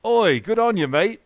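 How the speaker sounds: loud, high Aussie greeting; broad mock Australian accent. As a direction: shout it like a hammed-up Australian. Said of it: "it's Australia".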